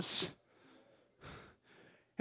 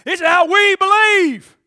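louder, higher, proclaiming we believe; bold declaration; 5 semitones higher, 7 dB louder than usual. Shown as a demonstration. Preacher shows the church how to declare belief, loudly. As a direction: proclaim it loud and proud.

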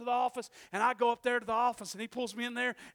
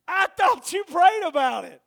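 higher, much louder; shouted repeat; 7 semitones higher, 15 dB louder than usual. Retold or imitated. Preacher repeating the wife to the crowd, shouting. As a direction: drive the wife's words home.

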